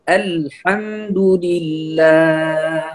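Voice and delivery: الحمد لله very slow, with light "al" and thin ح; correct light articulation. Shown as a demonstration. Teacher models for learners, slow light recitation of الحمد لله. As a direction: stretch الحمد لله out, every letter light. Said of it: "وأيضًا الحاء".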